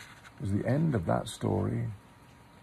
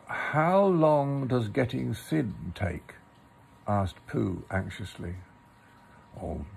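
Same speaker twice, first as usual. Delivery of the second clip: story narration; 5 semitones higher than usual. A narrator's reading. Reads a Pooh story aloud.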